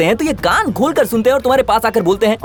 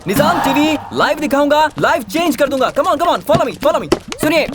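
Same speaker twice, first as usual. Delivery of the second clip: drawn-out, sing-out news delivery; sensational TV reporter; 4 semitones higher than usual. Acted. Reporter tells viewers about the lorry jam, sensational delivery. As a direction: declaim it like a street news report.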